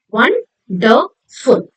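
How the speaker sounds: slow 'wonderful', broken into won-der-ful; syllable by syllable. Shown as a demonstration. Teacher showing children three syllables, syllable by syllable. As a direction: say 'wonderful' in three separate beats. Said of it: "Three syllables".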